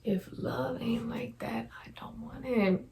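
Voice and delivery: breathy whisper, barely voiced. Real, not acted. Booktuber whispers her verdict on true love. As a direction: murmur it to the camera as a vow.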